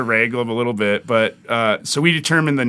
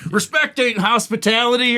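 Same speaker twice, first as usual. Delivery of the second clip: higher mocking imitation of her; drunk heckler retort; 8 semitones higher than usual. Comic repeats heckler's retort, mocking higher voice. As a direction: mimic the woman's dismissive comeback. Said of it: "She was like".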